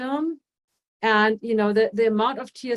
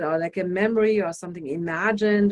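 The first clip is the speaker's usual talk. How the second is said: usual speaking voice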